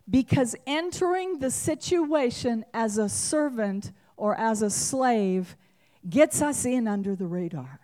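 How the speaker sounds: ordinary talk, nothing marked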